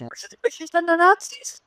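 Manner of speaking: very high-pitched incredulous question; incredulous retort. Acted. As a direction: throw it back in a squeaky, rising voice. In-character retort to an accusation.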